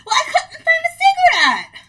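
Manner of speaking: laughing protest. Real, not acted. Defends herself, laughing, to co-host.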